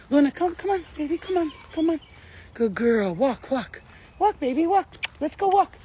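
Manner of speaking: high, sing-song coaxing of a little dog. Real, not acted. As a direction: lure the puppy along sweetly. Coaxing her dog to walk.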